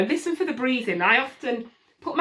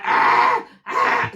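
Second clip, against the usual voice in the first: loud high mock baby scream; imitated angry wail, loud and high; angry baby cry; 3 semitones higher, 6 dB louder than usual. Shown as a demonstration. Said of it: "when I say angry, the voice is very different".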